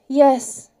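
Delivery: ordinary delivery, nothing marked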